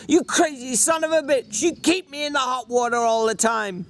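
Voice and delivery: high, almost shouting insult; near shout. Acted. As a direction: let it burst out, nearly a yell. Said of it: "His voice rose to a nearly shout".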